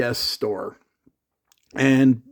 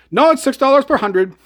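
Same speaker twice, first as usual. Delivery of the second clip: insisting father; 9 semitones higher, 4 dB louder than usual. Retold or imitated. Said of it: "Grifty goes".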